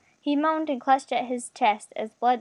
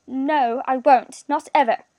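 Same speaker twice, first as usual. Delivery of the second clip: louder, frightened refusal; trembling stammer; 3 semitones higher, 7 dB louder, slower than usual. A narrator's reading. Captive refusing his captor's order, stammering in fear. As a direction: refuse with a touch of panic. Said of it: "he stammered".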